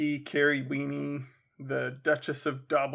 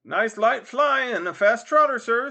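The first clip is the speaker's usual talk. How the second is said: high, loud sales cry; touting coachman; 9 semitones higher, 10 dB louder than usual. Acted. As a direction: pitch the ride across a busy street. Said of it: "said another".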